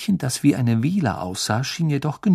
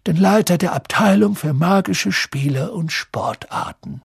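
Dumbledore's address; 8 semitones higher, 3 dB louder than usual. A narrator's reading. Dumbledore introduces guests to the students, ceremonious. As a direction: announce grandly to the whole hall.

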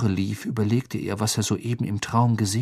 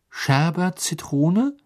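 hesitant guess; 8 semitones higher, much slower than usual. Acted. Harry guesses the password.

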